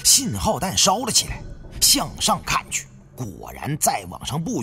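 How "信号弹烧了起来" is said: ordinary delivery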